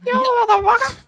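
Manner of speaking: very high squeaky voice; fast-forwarded recording. Retold or imitated. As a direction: squeak it like a sped-up tape.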